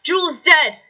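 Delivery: a high, loud alarmed call. Acted. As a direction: shout the news across the monastery. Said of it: "you hear a voice call".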